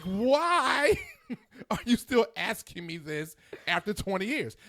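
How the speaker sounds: high laugh breaking into the question; laughing exasperation. Real, not acted. Husband laughing in disbelief at wife's questions.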